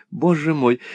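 the second боже мой sinking back down; fading dismay. A narrator's reading. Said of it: "воскликнула мисс Лакриви". (тише, затихающий испуг)

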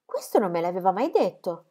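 ordinary delivery

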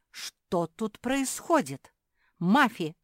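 high, drawn-out shocked demand; dismayed exclamation. A narrator's reading. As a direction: exclaim it slowly, aghast. Всплеснула лапами, увидев беспорядок.

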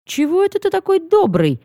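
high-pitched, incredulous question; unable to resist asking. A narrator's reading. Heroine can't resist questioning Dar, high-pitched and incredulous. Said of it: "не удержалась я".

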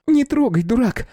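put-on very high girl's outcry; startled cry. A narrator's reading. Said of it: "вскрикнула она".

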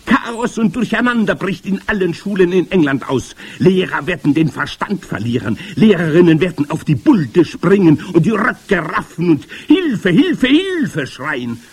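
high, loud gloating rant; excited high-pitched witch voice; grand witch. A narrator's reading. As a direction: revel in the chaos as a witch leader. Grand witch rants about chaos.

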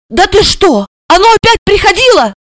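high, quick avid question; thrilled, high-pitched curiosity; eagerly excited. A narrator's reading. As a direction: ask it agog, rushing the words. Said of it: "азартно спросила".

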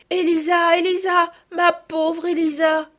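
high, loud call, slowing at the end; crying out. A narrator's reading. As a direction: cry out her name with emotion. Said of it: "s'écrièrent Camille et Madeleine".

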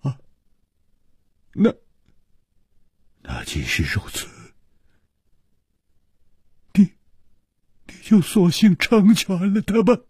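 halting, husky plea; falter, then push the request out hoarsely; husky stammer rising high at the end. A narrator's reading. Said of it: "哑声说".

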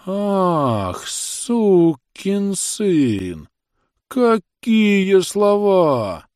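high, very drawn-out mock amazement; astonished sing-song. A narrator's reading. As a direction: sing it out in amazement, stretching each word high. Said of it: "свистящим голосом изумлённо запел". (изумлённо, нараспев, высоким голосом)

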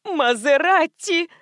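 high, drawn-out excited cry; gleeful, stretched-out squeal; excited scream. A narrator's reading. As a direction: yell it out with gusto. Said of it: "азартно завопила девушка".

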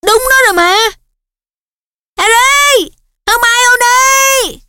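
high, loud joyful yell; shouting. A narrator's reading. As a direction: shout across to friends. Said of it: "Ron thét vang".

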